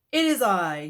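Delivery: loud, grand declaration; mock-heroic principal; heroic announcement. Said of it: "he said".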